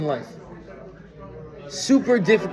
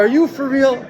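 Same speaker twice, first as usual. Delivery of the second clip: host exasperated at a guest's unstripped linen, disbelieving; incredulous; 7 semitones higher than usual. Retold or imitated. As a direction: throw up your hands in mock outrage. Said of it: "And you're like".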